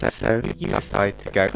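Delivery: usual speaking voice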